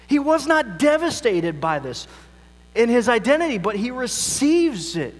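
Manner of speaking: fervent preacher building his point; impassioned preaching. Real, not acted. Preaches at full force.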